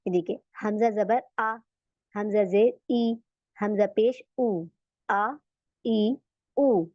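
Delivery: आ ई ऊ said slowly and clearly; hamza vowel demo. Shown as a demonstration. Teacher demonstrating the hamza vowels to students. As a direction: sound out आ ई ऊ for students. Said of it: "हमजा का देखते हैं".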